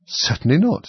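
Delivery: high-pitched mild indignation; indignant. A narrator's reading. Alice answers the question about washing, indignantly. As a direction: deny it as if affronted. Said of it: "said Alice indignantly".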